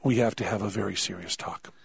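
low, quiet, serious tone; grave doctor. Retold or imitated. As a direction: imitate a doctor turning suddenly grave. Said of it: "his tone just changes entirely".

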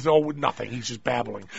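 low, soft imitated brush-off; dismissive mother. Mother explaining away her babbling child, mock offhand dismissal. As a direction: play a parent waving it away, quietly. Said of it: "they kept going".

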